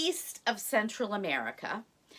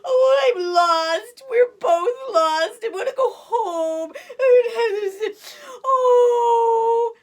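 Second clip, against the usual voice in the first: high, loud slow wailing; crying tiger cub; 13 semitones higher, 14 dB louder, slower than usual. Acted. Lost baby tiger to Cabri, loud high crying. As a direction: wail like a lost little cub. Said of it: "Tigre started crying again".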